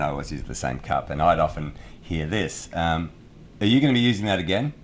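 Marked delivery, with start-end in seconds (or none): 3.6-4.7 quick, higher imitation of a pointed question; mock passive-aggressive. Housemate hinting about a left-out coffee cup, mock passive-aggressive. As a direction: ask it lightly with a hidden message behind it. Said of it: "I'll give you an example".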